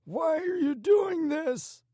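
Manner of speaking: very high, loud, drawn-out wail; agonized cry. A narrator's reading. Tortured man pleading with his captor, agonized cry. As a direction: cry out slowly in agony. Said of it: "he cried out at Sticky".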